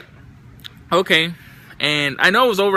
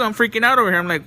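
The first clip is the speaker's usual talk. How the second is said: normal talking voice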